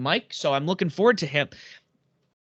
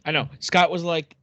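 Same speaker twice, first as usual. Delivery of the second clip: usual speaking voice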